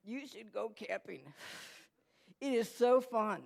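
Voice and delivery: slow, strained stoner voice; pot-smoking stepdad. Retold or imitated. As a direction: talk slowly as if holding in smoke. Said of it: "he would smoke pot".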